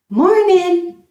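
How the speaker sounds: "Morning" with no G, easygoing; a casual "Morning" dropping the G; relaxed greeting. Shown as a demonstration. Teacher leading students in the informal "Morning". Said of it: "No G sound".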